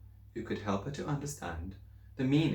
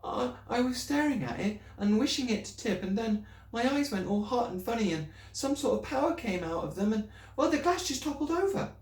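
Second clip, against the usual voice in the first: put-on higher, anxious little girl's voice; girl pleading with her teacher, higher and anxious; young Matilda; 14 semitones higher than usual. A narrator's reading. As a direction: speak up as a worried schoolgirl.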